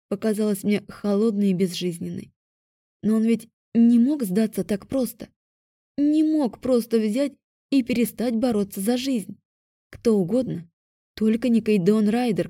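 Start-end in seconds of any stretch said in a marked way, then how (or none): none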